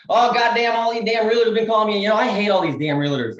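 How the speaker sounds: mock an annoyed New Yorker griping on the phone; hammed-up fed-up caller; irate New York woman. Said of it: "a girl from New York and she's like".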